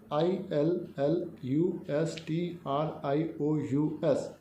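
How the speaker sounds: letters spelt out very slowly, one by one; slow spelling. Shown as a demonstration. Teacher spelling इलस्ट्रस for students, slowly. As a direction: dictate each letter carefully. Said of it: "इलस्ट्रस".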